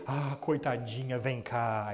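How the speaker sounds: slow, drawn-out cooing sympathy; mock pitying coo. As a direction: imitate an adult fussing over a poor little one. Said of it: "A nossa tendência ... natural é o quê?".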